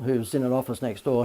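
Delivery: ordinary talk, nothing marked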